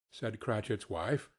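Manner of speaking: ordinary delivery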